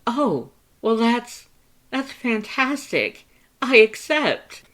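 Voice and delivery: very high, loud, slow gushing; overwhelmed with joy at friendship; touched elation. Acted. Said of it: "touched elation".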